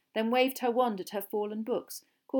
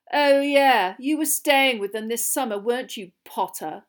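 mild sneer, starting loud and higher; 4 semitones higher, 9 dB louder than usual. A narrator's reading. Malfoy taunting Potter about his summer, sneering. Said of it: "sneered Malfoy".

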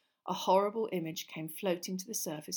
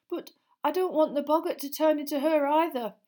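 put-on high, louder hesitant boy; nervous; 9 semitones higher, 9 dB louder than usual. A narrator's reading. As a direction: answer jumpily, pitched right up. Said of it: "said Neville nervously".

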